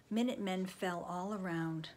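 ordinary delivery

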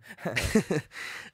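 short relieved laugh; nervous laugh. Acted. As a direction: laugh off the fear. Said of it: "I let out a laugh".

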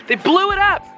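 high, incredulous outburst; outraged punchline; indignant exclamation. Real, not acted. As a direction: exclaim it in disbelief, pitch shooting up. Exasperated at the castle's destruction.

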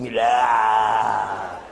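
loud menacing growl; monster growl. Acted. Comedian imitating the frightening president, loud growl. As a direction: growl it to scare. Scary creature face.

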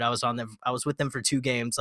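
ordinary talk

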